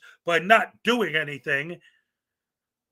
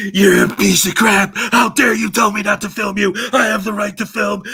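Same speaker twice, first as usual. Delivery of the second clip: loud, furious yell; angry shouting auditor; 3 semitones higher, 9 dB louder than usual. Acted. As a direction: bellow at the clerk in outrage. Acted auditor yelling at clerk.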